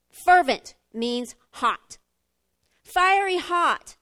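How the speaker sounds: drawn out, each word weighted; slow and emphatic. Real, not acted. Preacher slowly explains fervent to the church, emphatic. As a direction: lean on every word slowly.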